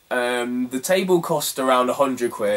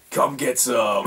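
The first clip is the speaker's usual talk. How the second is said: game announcer line; much slower than usual. Retold or imitated. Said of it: "it says, like".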